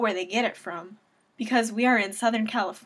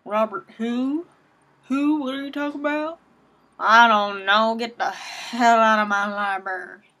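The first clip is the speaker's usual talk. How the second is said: hammed-up Southern accent for a clueless librarian; mock Southern accent; 5 dB louder than usual. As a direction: play an ignorant librarian with a broad Southern accent, getting loud. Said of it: "I use that accent".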